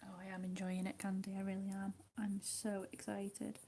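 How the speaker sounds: ordinary talk, nothing marked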